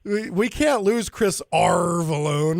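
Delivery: slow, hammed-up pirate drawl; pirate accent. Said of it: "the pirate dialed accent".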